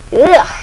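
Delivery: high, loud "ugh"; disgusted groan. Acted. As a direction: recoil in disgust. Disgusted by beast blood.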